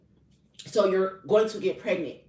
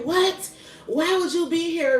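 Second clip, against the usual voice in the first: high, incredulous outburst; imitated shocked coworker; 10 semitones higher, 4 dB louder than usual. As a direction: mimic a coworker's disbelief, pitch shooting up. Retelling coworker's shocked reaction.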